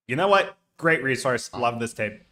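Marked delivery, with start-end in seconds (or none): none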